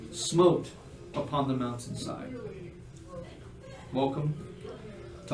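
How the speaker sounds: atmospheric scene narration. Acted. Game master revealing a silent ruined house, atmospheric narration. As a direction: build atmosphere with pauses, then drop away.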